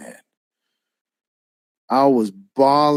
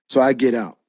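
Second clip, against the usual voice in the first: ordinary talk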